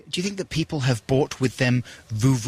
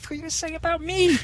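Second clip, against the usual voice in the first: squeaky falsetto, quick and indignant; mock outrage; faster than usual. Teasing his co-host in a squeaky falsetto. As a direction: squeak it fast in mock offence.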